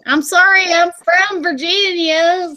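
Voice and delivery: exaggerated Virginia drawl; southern drawl. Real, not acted. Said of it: "so I talk like a hillbilly".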